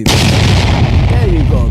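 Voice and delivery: very slow and loud, stretched; milk the words for the viewer; drawn-out hype. Real, not acted. Rewarding a viewer in chat, very slow and loud.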